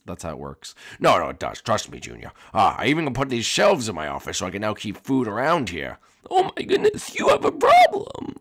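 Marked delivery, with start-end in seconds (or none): 6.3-8.4 high, put-on voice, gasping at the problem; a put-on high voice, scandalized; shocked high voice. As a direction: squeal it out in a higher character voice. Shocked character reacting to obesity.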